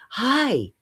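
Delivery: fake ad read. Shown as a demonstration. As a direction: squeal the greeting like a fake ad. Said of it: "this fake style".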